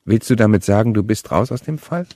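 ordinary delivery, nothing marked

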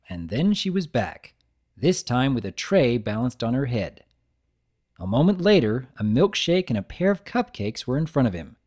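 plain reading voice

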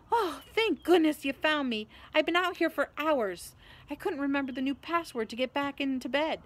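high, loud, plaintive relief; relieved Neville. A narrator's reading. Neville, found after hours locked out, plaintively relieved.

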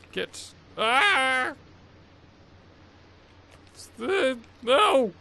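sudden high frustrated cry; frustrated yelp. Real, not acted. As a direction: yelp at the screen.

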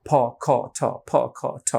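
ফ, খ, ঠ each with a strong puff of air; puffed plosives. Shown as a demonstration. Teacher shows the wrong habit, strong puffed plosives. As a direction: blow air hard on ফ, খ, ঠ. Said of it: "প্লোসিভ অর্থাৎ বাতাসের একটা ধ্বনি বের করে উচ্চারণ করতাম".

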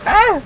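a high, loud startled shriek; sudden scream. Real, not acted. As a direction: let out a real yelp of fright. Startled while riding the wave.

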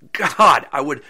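loud, high exasperated outburst. Real, not acted. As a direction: groan it out, fed up. Exasperated at the thought of slow travel.

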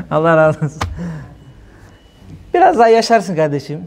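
ordinary talk

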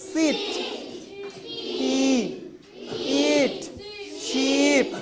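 long, held ee in "Sit", "He", "Eat", "Sheep"; "Sit", "He", "Eat", "Sheep" with lengthened vowels; drawn-out vowel. Shown as a demonstration. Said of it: "এইগুলো একটু লম্বা করে".

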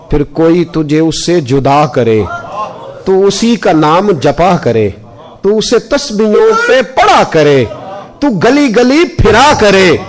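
slow, rising poetic recitation; recited verse. Acted. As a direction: chant the curse like a ghazal, building. Recites curse couplet, chant-like. (धीमी, चढ़ती हुई शायराना अदायगी)

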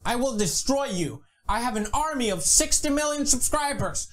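ordinary talk

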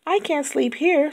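high, whiny little ladybug. A narrator's reading. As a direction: pitch it way up like a tiny fed-up bug. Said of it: "said the lazy ladybug".

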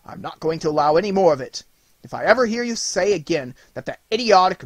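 a father forbidding the nonsense; earnest scolding. A narrator's reading. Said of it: "said with much earnestness".

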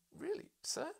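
very high startled query; surprised question. Acted. Narrator to professor, a high startled query. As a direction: squeak out the doubt.

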